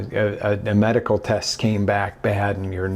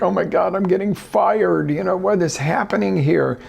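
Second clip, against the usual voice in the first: higher, mock-panicked voice; 7 semitones higher than usual. Coach imitates a panicked employee called to the boss. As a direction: play the worker sure of being fired. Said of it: "And you're like".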